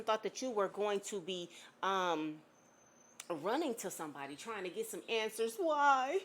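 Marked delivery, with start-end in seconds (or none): 5.6-6.2 mock pleading ex. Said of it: "this is what somebody literally thought".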